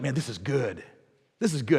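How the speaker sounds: low, soft easygoing quote; friend's catchphrase. Retold or imitated. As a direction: lightly voice the friend's happy refrain. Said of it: "he always had this saying that was like".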